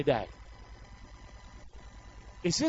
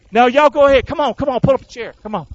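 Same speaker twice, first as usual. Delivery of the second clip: jeering soldier; 3 semitones higher, 8 dB louder, faster than usual. Acted. Soldiers inviting others to watch.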